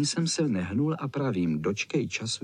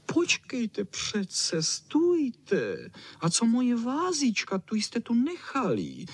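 high, plaintive dog whine; whining chow chow; 11 semitones higher, slower than usual. A narrator's reading. Chow chow left behind with the vase, high whine. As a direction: whine it high, calling after them. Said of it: "kňučel Čau Čau".

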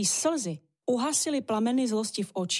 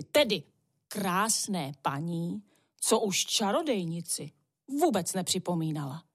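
ordinary delivery

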